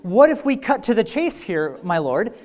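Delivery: hammed-up impatient advisor losing it; exasperated pretend counselor. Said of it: "what if it went something like this?".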